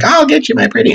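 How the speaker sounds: high mock witch threat; shrieking wicked witch, hammed up. Teacher impersonating the Wicked Witch of the West, high hammed-up witch. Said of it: "And she's like".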